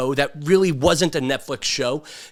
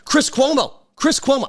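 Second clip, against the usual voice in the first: raised name; 9 semitones higher than usual. Acted. Name repeated for effect.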